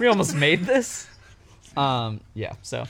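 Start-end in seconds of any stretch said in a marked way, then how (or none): 0.0-1.1 mock studio panic. Studio realizing what they nearly made.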